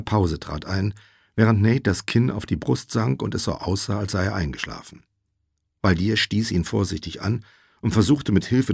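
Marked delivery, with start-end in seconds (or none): none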